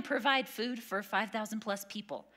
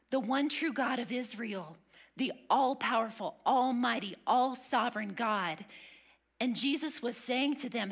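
ordinary talk

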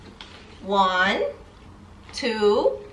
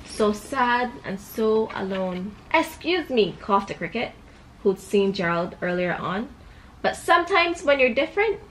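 put-on storybook voice for a little girl; reading to a child; 4 semitones higher than usual. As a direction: read it like a bedtime book. Reads picture book to child.

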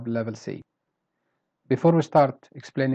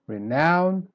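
"Renown" higher on "nown"; weak then strong; 4 semitones higher than usual. Shown as a demonstration. Teacher repeating for learners, "Renown" stressed on the second syllable. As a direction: lift the end of "Renown". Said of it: "start weak and then you go".

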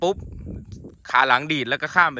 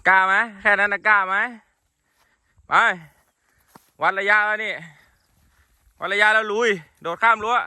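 higher-pitched urging call to an animal; 5 semitones higher than usual. Real, not acted. Daring his horse to jump the fence, urging it on.

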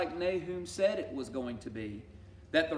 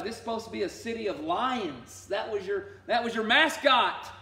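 God taunting; 6 semitones higher, 5 dB louder than usual. Acted. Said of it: "He's like".

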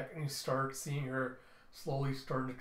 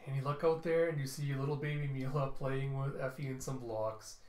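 ordinary talk

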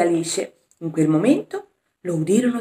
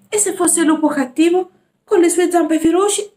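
put-on higher, fearful child's voice; scared little boy; 9 semitones higher, 6 dB louder than usual. Jack asks Alice, scared of the wolf, higher fearful voice. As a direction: squeak it out like a frightened boy.

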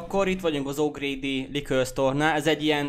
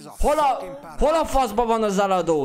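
exasperated shout; 7 semitones higher, 3 dB louder than usual. Real, not acted. Shopkeeper missing, exasperated.

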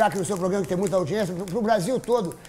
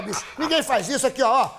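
ordinary talk, nothing marked

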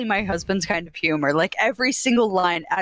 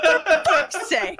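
slow, drawn-out exasperated groan with a laugh; 5 semitones higher, much slower than usual. Retold or imitated. Fed up with friends who side with Cody, slow exasperated sigh. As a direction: drag it out in mock despair.

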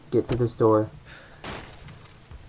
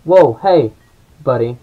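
startled shout; 9 dB louder than usual. Real, not acted. Startled by enemy in game.

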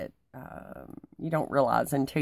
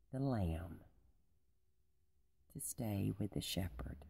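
hushed whisper; 9 semitones lower, 15 dB quieter than usual. Real, not acted. Hushed climax of the lesson.

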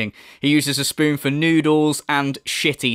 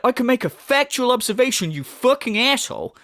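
high-pitched imitation of an angry streamer; mock indignant rant; 8 semitones higher than usual. Commentator mimicking the streamer answering a small comment, mock indignant. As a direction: mimic his outrage, pitched well up. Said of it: "He's like".

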